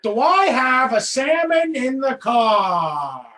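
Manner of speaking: slow, loud Pacino delivery; drawn-out hammy Pacino; Pacino impression. Pacino-style joke line.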